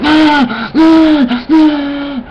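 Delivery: repeated high loud "Ah" to drown you out; hammed-up yelps cutting someone off; mock yelling over. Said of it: "what they would do is".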